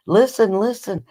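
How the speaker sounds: high little girl voice, imitated; lightly voiced toddler; child voice. Child voiced teaching swear.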